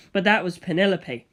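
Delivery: ordinary delivery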